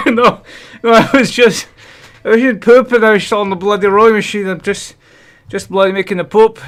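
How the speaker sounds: high, loud winded protest; out of breath. Real, not acted. Streamer excusing himself from the rowing machine, winded. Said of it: "I'm out of breath".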